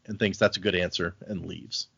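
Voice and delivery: normal talking voice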